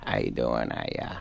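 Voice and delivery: low, soft mumbling, words trailing off; Adam Sandler mumble. Retold or imitated. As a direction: mumble it like Sandler, lightly voiced. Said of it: "he just kinda mumbles".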